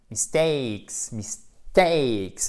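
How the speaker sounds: "mistakes" with a strong, long "ay" in "takes"; powerful diphthong. Shown as a demonstration. Teacher drilling students, "mistakes" with powerful stressed "ay". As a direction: lean hard on "takes" in "mistakes", falling. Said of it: "Faut vraiment faire ... qui est puissant".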